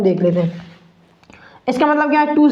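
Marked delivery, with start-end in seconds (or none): 0.0-0.7 whispered aside. Real, not acted. Muttered aside to himself.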